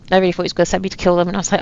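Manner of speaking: normal talking voice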